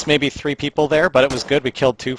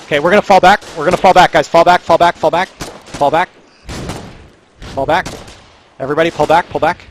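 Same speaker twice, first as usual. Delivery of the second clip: frantic retreat order; 3 semitones higher, 3 dB louder than usual. Real, not acted. Squad leader ordering urgent retreat.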